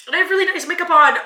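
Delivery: high, loud teary wail; half-laughing through sobs; crying laugh. Real, not acted. Vlogger wailing about makeup while crying.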